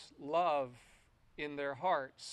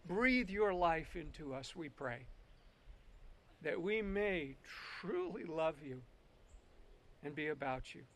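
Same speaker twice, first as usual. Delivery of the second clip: earnest prayer, loud then hushed; 9 dB louder than usual. Acted. Leads congregation in prayer.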